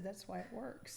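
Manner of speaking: hoarse, croaky laryngitis voice; frog voice. Real, not acted. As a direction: speak through a sore, hoarse throat. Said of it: "I sound like a frog".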